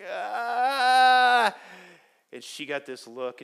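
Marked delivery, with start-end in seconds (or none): 0.1-1.5 high, loud stalled "ah" of embarrassment; caught-out groan. Retold or imitated. Pastor caught forgetting the girl's name, embarrassed groan. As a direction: freeze up, caught not knowing. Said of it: "And I'm like".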